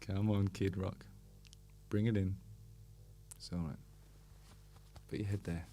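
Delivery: hushed soothing tone, as to a sad friend; soft comforting. Pretend. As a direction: coax him gently into a hug. Comforting imagined [name].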